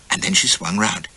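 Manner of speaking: usual speaking voice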